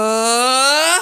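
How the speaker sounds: high and loud put-on voice; high voice change. Shown as a demonstration. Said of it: "j'ai fait une différence dans ma voix".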